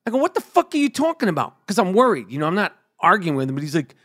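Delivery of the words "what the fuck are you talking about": jumps much higher in retold alarm; alarmed disbelief. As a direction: sound shocked and worried. Retelling shock at father's injury.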